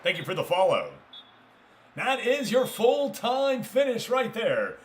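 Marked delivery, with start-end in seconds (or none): 2.0-4.8 high, slow, drawn-out broadcaster announcement; mock commentator call. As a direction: call the final whistle like a TV commentator. Mock commentator final whistle call.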